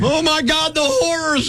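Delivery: mock horror. Mock outrage at complaint.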